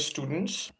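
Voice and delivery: ordinary talk, nothing marked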